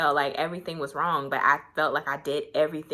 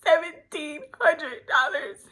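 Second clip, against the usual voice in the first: whiny plea; 12 semitones higher than usual. Retold or imitated. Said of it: "I was like".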